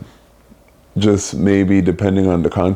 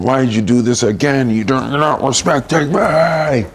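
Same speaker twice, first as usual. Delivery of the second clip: mock angry scolding; 7 semitones higher than usual. Man imitates himself yelling at his partner, mock angry. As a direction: scold someone who keeps disrespecting you, hammed-up. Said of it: "me repeating myself".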